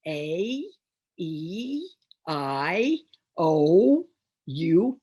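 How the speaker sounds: A, E, I, O, U each drawn out separately; gliding long vowels. Shown as a demonstration. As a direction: stretch each vowel name, gliding upward. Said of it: "they're two mouth positions".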